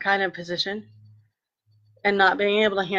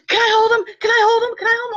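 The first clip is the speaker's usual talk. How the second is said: much higher, louder repeated pleading; eager begging; 4 dB louder, faster than usual. Retold or imitated. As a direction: beg like an excited sister who can't wait. Sister begging to hold baby.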